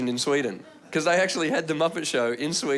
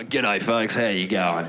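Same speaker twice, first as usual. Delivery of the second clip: low Aussie celebrity greeting; low, blokey Australian; Russell Crowe; 7 semitones lower, much slower than usual. Retold or imitated. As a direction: open a speech in a low Australian voice. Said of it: "Russell Crowe".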